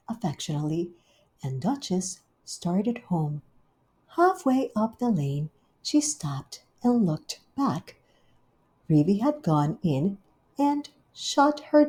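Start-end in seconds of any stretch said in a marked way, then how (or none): none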